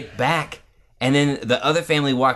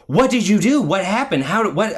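higher, louder mock parental alarm; imitated frantic questioning; 6 semitones higher, 3 dB louder than usual. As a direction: fire off questions like a flustered parent. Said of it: "you would have these reactive questions".

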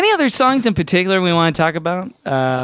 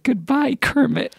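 high, softened puppet farewell; gentle, raised puppet-bird voice; Big Bird; 8 semitones higher, 3 dB quieter than usual. Retold or imitated. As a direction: tenderly call goodbye up to the sky. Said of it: "looked to the sky and said".

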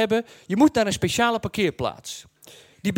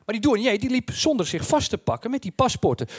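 ordinary talk, nothing marked

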